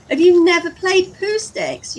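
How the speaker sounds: very high, loud disbelief; mock astonishment. Vlogger to viewers who never played the game, mock astonished. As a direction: answer as if scandalised they don't know it.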